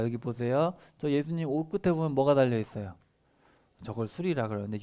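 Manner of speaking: ordinary talk, nothing marked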